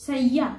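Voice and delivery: শয্যা as "shay-ya", y sounded in place of j; Sanskrit pronunciation. Shown as a demonstration. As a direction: say শয্যা with a clear double y. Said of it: "সংস্কৃতে সেটা হবে".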